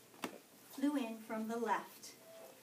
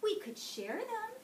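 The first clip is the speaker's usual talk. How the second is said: friendly suggestion; 11 semitones higher than usual. A narrator's reading. Friendly ladybug offers to share breakfast, higher and gentle. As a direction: sweetly propose sharing. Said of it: "suggested the friendly ladybug".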